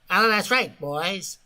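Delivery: Edward G. Robinson. Acted. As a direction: put on a hammy old film star's voice. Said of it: "it's my impersonation of Edward G".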